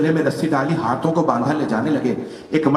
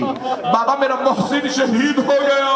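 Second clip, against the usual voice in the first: much higher, slow, crying out to her father; keen it like a grieving daughter at a grave; wailing lament; 12 semitones higher than usual. Acted. Preacher voicing Fatima crying to her father, high wailing lament.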